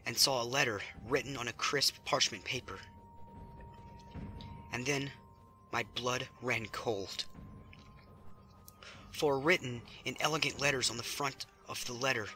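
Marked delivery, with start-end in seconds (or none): none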